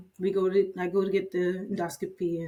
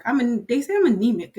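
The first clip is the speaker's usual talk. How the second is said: louder, raised explanation; emphatic; 5 semitones higher, 8 dB louder than usual. Retold or imitated. Nurse explains to her boss why she needs a referral, louder. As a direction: stress that they say so.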